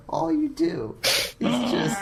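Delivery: high, slow whining; hammed-up whine of self-pity; whiny voice. Whiny mock complaint.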